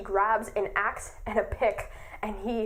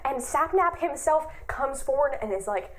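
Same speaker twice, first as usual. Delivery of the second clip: ordinary talk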